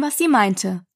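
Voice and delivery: ordinary delivery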